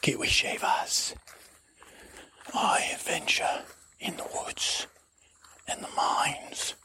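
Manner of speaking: slow, mysterious stage whisper. Acted. Adventurer introducing himself to the ranger, slow whisper. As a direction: whisper it in slow, separate phrases. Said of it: "why are you whispering?".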